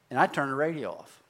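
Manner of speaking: ordinary talk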